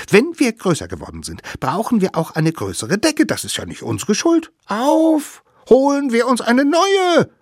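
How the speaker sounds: high, eager little savage; fast and rising, getting everyone going; excited child voice. A narrator's reading. Little savage rallying the others.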